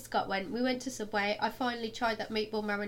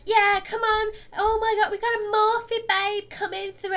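high, loud singsong cheer; mock hyped staff; 11 semitones higher, 9 dB louder than usual. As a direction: cheer like a shop girl hyping a crowd. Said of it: "they're all just like".

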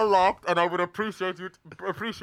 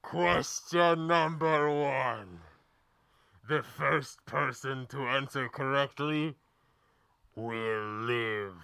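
slow, low, sinking villain threat; ominous hooded stranger; 6 semitones lower, 7 dB quieter, slower than usual. Acted. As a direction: let it drop lower and quieter. Villain announces deadly question.

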